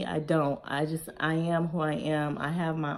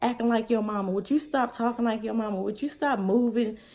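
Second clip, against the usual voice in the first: a hint of a nagging husband, a bit higher; lightly mock the husband's complaint; imitating husband; 6 semitones higher than usual. Husband nagging her to stop acting like her mom, lightly imitated. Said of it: "Even my husband be like".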